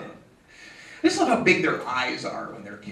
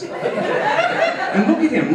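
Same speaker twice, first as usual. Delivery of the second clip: mock pity; 11 semitones higher, much slower than usual. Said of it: "mock referee lecturing".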